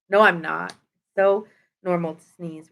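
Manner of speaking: normal talking voice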